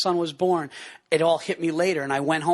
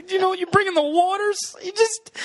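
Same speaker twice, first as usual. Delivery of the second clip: very high fake weepy voice; hammed-up tearful gushing; mock overcome with emotion; 12 semitones higher than usual. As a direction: pretend you can't finish for crying. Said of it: "he gets a little emotional".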